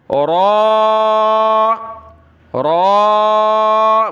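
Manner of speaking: "را" heavy and rounded, held long; thick, full-mouthed. Shown as a demonstration. Teacher demonstrates heavy letter, "را" thick and long. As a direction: open the mouth and sustain a dark "را". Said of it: "موٹا کرنا ہے اس حرف کو".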